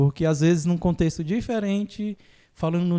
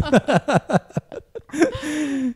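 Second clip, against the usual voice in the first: long, high-pitched laugh; real high laughter; hearty laugh; 9 semitones higher than usual. Real, not acted. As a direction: laugh helplessly in a rising cackle. Laughs at own quick joke.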